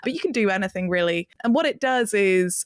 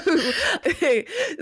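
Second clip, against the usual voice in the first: high burst of laughter; laugh, caught off guard by the question; an amused high giggle; 10 semitones higher than usual. Real, not acted.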